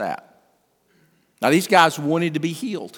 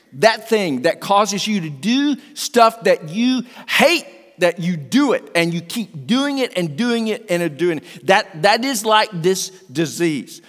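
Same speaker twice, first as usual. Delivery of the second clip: louder, higher preaching voice, full of urgency; fervent preaching; 5 semitones higher, 5 dB louder than usual. Acted. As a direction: preach it out with fire to the congregation. Preaching to congregation.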